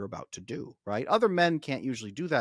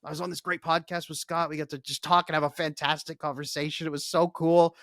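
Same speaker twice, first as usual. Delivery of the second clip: brighter and louder, a bit excited; upbeat recounting; 4 semitones higher, 5 dB louder than usual. Retold or imitated. Husband telling wife about his podcast, mildly excited. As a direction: tell her it was so cool, pitched up. He answers wife's question.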